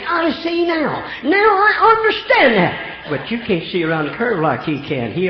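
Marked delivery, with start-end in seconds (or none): 0.0-1.0 high, slow, amazed realization; realizing, excited. Acted. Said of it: "After a while we say". 1.2-2.9 high and loud, joyful understanding; excited realization. Acted. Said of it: "After a while we say".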